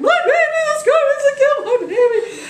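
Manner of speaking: very high, loud hammed-up wail; screaming, crying. Woman up on the hill, acted screaming and crying. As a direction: scream it out like a distraught mother. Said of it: "she hears this screaming woman up on the hill crying".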